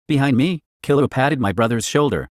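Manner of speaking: ordinary delivery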